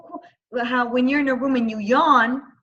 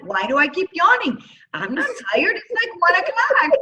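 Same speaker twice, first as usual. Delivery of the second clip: puzzled disbelief; 9 semitones higher, 4 dB louder than usual. Retold or imitated. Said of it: "I'm like".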